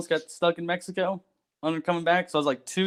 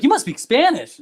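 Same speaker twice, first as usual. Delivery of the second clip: put-on excited older gentleman; 9 semitones higher, 4 dB louder than usual. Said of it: "He's like".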